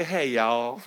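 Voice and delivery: friendly Southern drawl greeting; Southern accent. Acted. Preacher mimicking people in the South, Southern accent. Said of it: "And in the South, they say".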